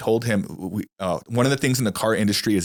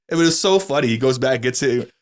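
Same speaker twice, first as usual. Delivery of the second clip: ordinary talk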